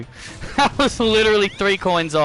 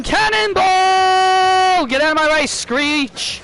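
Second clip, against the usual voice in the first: high, loud, slow battle cry; screaming; 11 semitones higher, 4 dB louder than usual. Retold or imitated. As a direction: scream it like a charging hero. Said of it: "and he'll scream".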